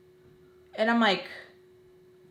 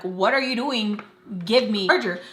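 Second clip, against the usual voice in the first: usual speaking voice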